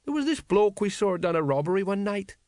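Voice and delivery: high, plaintive storytelling; miserable. A narrator's reading. Tipsy sergeant recalling a comrade's fatal night, miserably. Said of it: "said Colon miserably".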